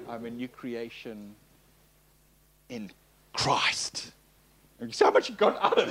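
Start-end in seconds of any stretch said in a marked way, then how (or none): 0.1-4.1 long pauses, then "Christ" in a forceful whisper; stress on CHRIST. Shown as a demonstration. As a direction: hold back, then release "Christ" on pure breath. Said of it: "put the emphasis on a different word".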